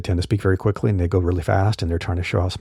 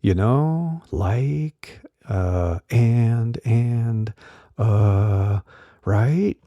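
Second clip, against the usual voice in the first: drawn-out hesitant fillers, some pitched up; filler-word hesitation; 2 semitones higher, much slower than usual. Shown as a demonstration. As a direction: imitate a speaker stalling on verbal tics. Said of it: "those verbal tics and filler words".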